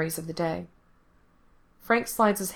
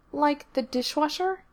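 put-on much higher child voice; little girl voice; 9 semitones higher than usual. A narrator's reading. Little girl waking Frank at night, high child voice. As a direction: voice the small girl, high and bright.

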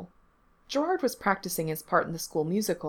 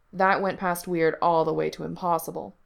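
ordinary delivery

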